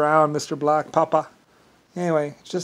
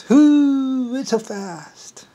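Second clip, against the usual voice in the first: high whoop easing into slow, drawn-out words; mocking imitation; 8 semitones higher, much slower than usual. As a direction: start on a long high hoot, then stretch it out. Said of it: "and then doing this".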